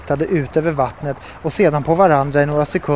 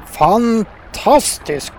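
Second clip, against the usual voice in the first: slow, stretched toad exclamation, pitch raised; impressed exclamation; 6 semitones higher, much slower than usual. A narrator's reading. Herr Buffo admiring the fishermen's job, impressed exclamation. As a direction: draw the word out in awe. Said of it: "utropade herr Buffo nu".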